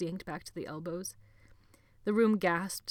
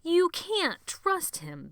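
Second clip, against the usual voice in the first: much higher, slow, loud insistence; insistent repeat; 12 semitones higher, 7 dB louder, much slower than usual. A narrator's reading. Benny repeating his warning, high and slow. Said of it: "Benny said again".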